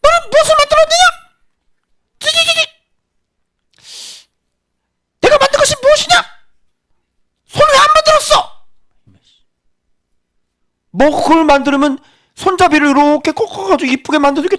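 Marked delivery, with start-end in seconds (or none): 0.0-8.5 very high squeaky pot voice, whining and complaining; a tiny pot nagging at its maker in a much higher voice; quarreling clay pot. Acted. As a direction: squeal up high like a cracked little pot picking a fight. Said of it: "그 토기장이에게".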